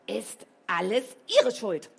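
loud accusing shout. A narrator's reading. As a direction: yell the blame at her. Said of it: "dass sie brüllte".

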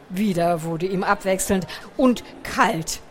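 ordinary delivery

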